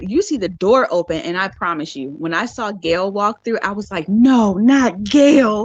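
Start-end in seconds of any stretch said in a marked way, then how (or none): 4.1-5.7 slow, drawn-out, louder dismay; mock dismay. Viewer seeing Gail walk through, mock dismay. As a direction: drag out a higher retold "no". Retells shocked reaction to Gail's entrance.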